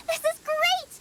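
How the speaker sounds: delighted shout; thrilled cry. Acted. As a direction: squeal with joy over the haul. Thrilled by the raw meat.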